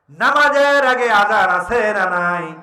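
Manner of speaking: slow, loud question to the crowd; forceful preaching. Real, not acted. Preacher asking the congregation about the call to prayer, proclaimed. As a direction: belt out the opening and draw it out.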